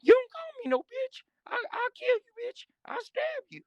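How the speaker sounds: very high, strained voice making threats; Whisper. Retold or imitated. Big inmate threatening whoever insulted him, imitated high strained voice. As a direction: squeeze threats out in a mimicked high rasp. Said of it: "Whisper's like".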